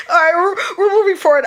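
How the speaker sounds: high, loud, quick recovery; hurried words through leftover laughter; tearful from laughing. Real, not acted. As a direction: pull yourself together, still teary. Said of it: "I'm doing too much crying".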